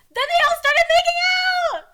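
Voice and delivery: high, loud scream of disbelief; excited shriek. Real, not acted. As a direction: shriek the twist out gleefully. Reader shrieks at story twist.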